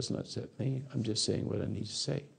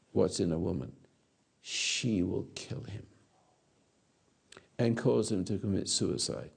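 ordinary delivery

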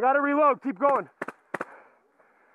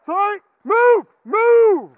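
loud, slow, drawn-out yell; urgent shout; 6 semitones higher, 9 dB louder, much slower than usual. Real, not acted. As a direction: push through shouting. Shoving past teammate urgently.

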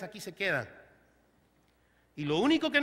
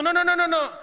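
mock refusal; 7 semitones higher, 8 dB louder than usual. Preacher acts refusing meat at restaurant.

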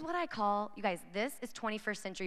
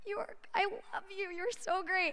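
gushing; 10 semitones higher than usual. Retold or imitated. Retold gushing reply to student.

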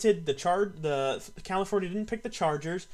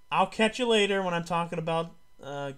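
ordinary talk, nothing marked

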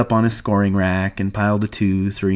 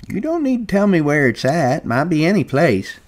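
put-on high old-man voice, placating; old Candy; 9 semitones higher than usual. A narrator's reading. Candy reassures guarded George, high old-man placating.

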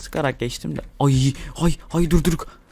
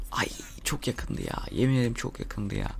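breathy whisper of relief, slowed down; relieved whisper; 3 semitones lower, 10 dB quieter than usual. Real, not acted. Gamer whispers to viewers after a near miss, relieved. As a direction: whisper it, almost got caught.

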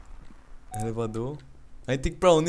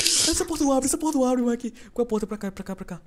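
being chased; 8 semitones higher, 7 dB quieter than usual. Real, not acted. Still fleeing monster.

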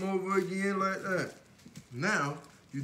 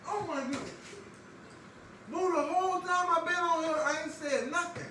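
high-pitched mock astonishment; excited; 11 semitones higher than usual. Real, not acted. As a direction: squeal it out, teasing her. Teasing [name] on the toilet.

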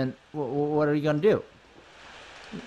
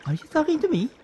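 higher, gentler questioning voice; character voice retake; 6 semitones higher, faster than usual. Acted. Gemma asking if she is being spoken to, high questioning voice. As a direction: pitch it up, milder this time. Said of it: "That's not Gemma's voice either".

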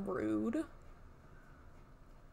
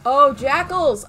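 loud, slow excited cry; excited shout; 3 semitones higher, 15 dB louder, slower than usual. Real, not acted. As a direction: shout out at spotting the enemy. Spots enemies in game.

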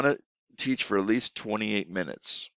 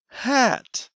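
high, clear single word; teacher showing a word. Shown as a demonstration. Teacher holding up a hat for a child, high and bright. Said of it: "I would show them".

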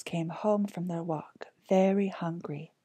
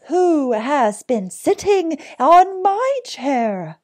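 higher, very loud, slow cry; raised, drawn-out storybook bear; middling-sized bear voice; 8 semitones higher, 12 dB louder, slower than usual. A narrator's reading. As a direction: call it out high as the middle bear. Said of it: "cried in a middling-sized voice".

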